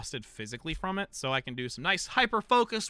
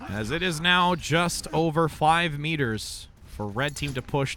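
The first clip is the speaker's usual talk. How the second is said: energetic esports commentary; play-by-play caster; 2 semitones lower, 5 dB louder, slower than usual. Acted. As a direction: call the action like a live caster. Resumes casting after chat.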